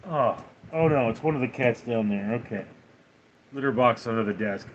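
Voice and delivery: usual speaking voice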